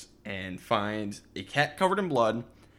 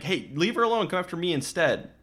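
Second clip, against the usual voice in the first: mild mock yell, pitched up; retold yell; 7 semitones higher, 3 dB louder than usual. Jamie drawing Michael away from her, retold yell. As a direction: imitate a defiant yell, higher but only half loud. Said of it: "Jamie yells at Michael".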